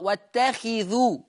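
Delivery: "واتخذوا" as wattakhidhu, i after kha; kha with kasra. Shown as a demonstration. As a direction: sound a clear khi in "واتخذوا". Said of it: "حفص يكسر الخاء".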